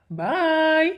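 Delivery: high, loud sing-song bye; taunting farewell; mocking goodbye. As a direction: wave her off with a sneer. Villain leaves mocking her.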